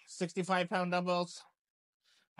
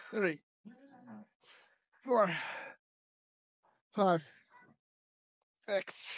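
strained rep count, pitched up; doing side laterals; 4 semitones higher, slower than usual. Real, not acted. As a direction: count reps while lifting hard. Emphasize the shoulders, not the traps.

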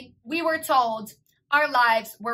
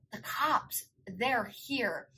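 soft, hushed warning; mock phone whisper; 8 dB quieter, much slower than usual. Brother warns his sister the cops came, mock hushed whisper. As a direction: imitate a frightened brother keeping his voice down. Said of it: "he's whispering to me on the phone".

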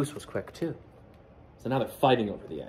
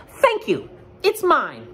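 very high and loud, smug; quacking Duck; 5 dB louder, slower than usual. Acted. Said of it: "quacked Duck".

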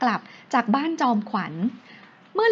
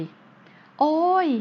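long drawn-out groan, pitched up; groan of pain; 6 semitones higher, much slower than usual.

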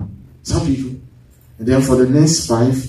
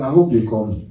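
hopeless hope; 7 semitones lower, faster than usual. Retold or imitated. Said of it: "you can tell that there's no hope".

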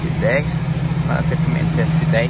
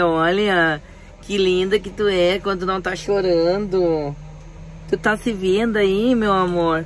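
slow, high tender cooing; 6 semitones higher, slower than usual. Real, not acted. Father cooing to calmed baby.

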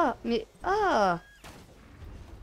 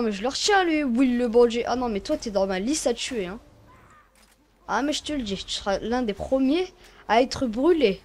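ordinary talk, nothing marked

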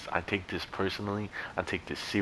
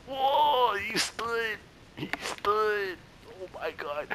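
high squealing astonishment, much higher; amazed gasp; much slower than usual. Real, not acted. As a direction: gasp it out, floored by what you see. Amazed at game character standing.

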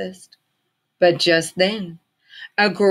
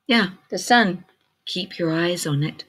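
plain reading voice

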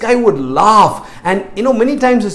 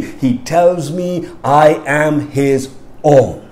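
slow, measured preaching litany; a preacher's slow, insistent litany; preaching declaration; 2 semitones lower, slower than usual. Acted. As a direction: declare each line with rising conviction.

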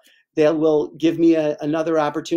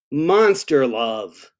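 slow, drawn-out refrain; 3 semitones lower, much slower than usual. Acted. Reader closes each verse for children, slow drawn-out refrain. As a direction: stretch each word of the refrain.

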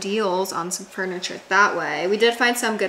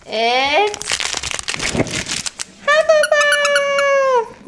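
gasp, then a much higher, louder, slow cry of the house; excited announcing; 8 dB louder, much slower than usual. Real, not acted. Sorting her dogs into houses, thrilled high announcing. Sorting-hat game with dog.

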